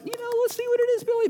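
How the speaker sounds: put-on high, sing-song mother's voice to a child; a mom nudging her boy to open the present; coaxing mom. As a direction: coax the child like an eager party mom. Mother voiced at birthday party.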